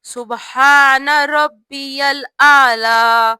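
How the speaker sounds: سبحان ربی الاعلی slowly with the full doubled b in ربی; slow correct pronunciation. Shown as a demonstration. Teacher giving the correct word, slow and clear. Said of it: "صحیح طریقہ".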